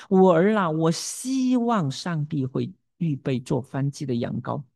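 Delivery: slow, with “希望” drawn out longingly; hopeful stress on 希望. Acted. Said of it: "这是他的盼望". (缓慢地拉长“希望”，带着盼望)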